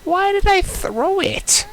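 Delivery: shocked outburst. Real, not acted. Player reacting to throwing it in the game, shocked outburst. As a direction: cry out slowly, high and loud, in disbelief.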